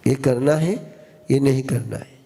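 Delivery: normal talking voice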